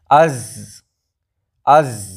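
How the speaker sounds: از with air flowing out after the z; از said with a long, breathy, open z; ze sound with air. Shown as a demonstration. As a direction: let the breath keep passing through the z of از. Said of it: "زے کے بعد آواز نکلنی چاہیے".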